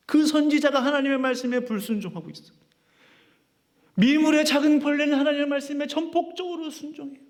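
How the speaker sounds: high, ringing preacher's voice; fervent preaching. Real, not acted. Pastor urging the congregation about Jonah, fervent preaching. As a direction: preach it out with rising fervour.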